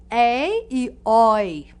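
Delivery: "ei" and "oi" drawn out, loud, with open vowels; open diphthong sounds. Shown as a demonstration. Teacher showing students the open diphthongs, slow clear demonstration. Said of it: "elas têm um som de".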